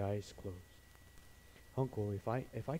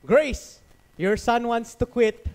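high, loud mocking call; dad calling out to his wife in scorn; 13 semitones higher, 13 dB louder, slower than usual. Dad telling his wife about their son, high and loud mockery. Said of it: "this is what he does".

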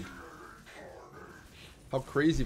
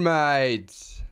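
ordinary talk, nothing marked

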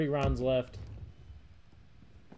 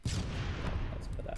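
whispering; 4 dB quieter, much slower than usual. Real, not acted. Unvoiced muttered aside.

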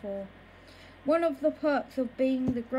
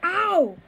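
sudden high loud ow; yelp of pain; 6 semitones higher, 7 dB louder than usual. Acted. Boy hit by a spoon, yelping in pain. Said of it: "I yelled".

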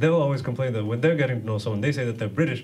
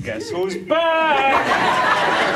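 drawn-out, much higher, loud proclamation; grand return call; 6 dB louder, much slower than usual. Retold or imitated. Comic in his robe walking into church, slow, loud grand entrance call. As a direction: announce yourself like a triumphant comeback. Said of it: "just go down to my local church like".

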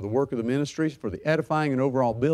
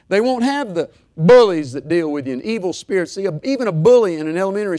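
louder, driving sermon voice; full-force preaching; 3 semitones higher, 7 dB louder than usual. Acted. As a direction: preach it hard to the church.

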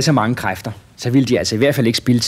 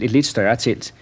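plain reading voice

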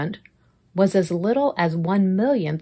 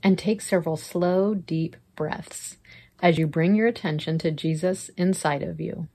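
guided prayer; 3 dB quieter than usual. Acted. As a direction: lead them gently into prayer.

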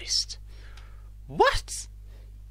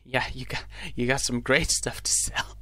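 drug addict voice; 8 semitones lower, 5 dB quieter than usual. Acted. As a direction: play a twitchy addict dealer. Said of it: "a drug addict voice".

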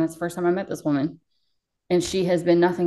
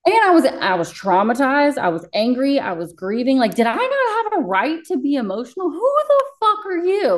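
rising indignation; 8 semitones higher, 3 dB louder than usual. Real, not acted. Venting to the host about her boss's cruelty, building furious cry. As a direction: let the outrage climb until it breaks.

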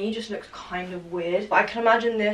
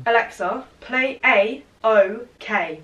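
louder and much slower, spelling it out; loud, slow command; 6 dB louder, slower than usual. Real, not acted. Repeating the request to Alexa, loud and slow. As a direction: say it slowly so the machine gets it.

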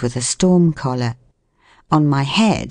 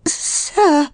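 very high, quavering woman's voice; 14 semitones higher than usual. A narrator's reading. Trapped woman addresses the man, quavering. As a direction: quaver it timidly, pitched right up. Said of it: "said I in quavering accents".

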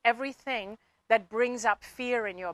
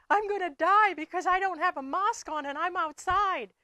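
put-on higher, childlike voice, a little louder; child's frightened words; 8 semitones higher, 3 dB louder than usual. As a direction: voice a scared little boy. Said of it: "And the kid said".

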